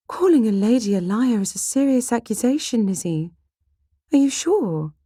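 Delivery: put-on high, scandalised young lady; aghast, high-pitched questioning; shocked protest. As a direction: object gravely, voice pitched right up. Jane shocked at the accusation.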